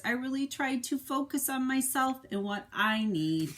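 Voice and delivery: expressive picture-book narration; reading to children. A narrator's reading. Parent reading the egg's story to a child, expressive narration. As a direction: tell it to a little one, with feeling.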